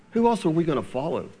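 ordinary talk

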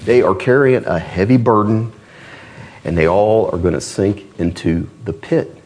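ordinary talk

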